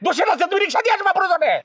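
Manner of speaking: very high-pitched indignant retort; exasperated high outburst; worked up, angry. Acted. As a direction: snap back in a high, strained pitch. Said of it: "আপনি শুধু শুধু খেপে যেতেছেন".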